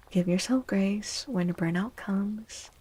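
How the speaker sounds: usual speaking voice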